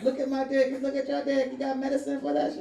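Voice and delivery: high, lightly put-on voice of a local; local man asking. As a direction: ask it like a curious local. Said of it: "He's like".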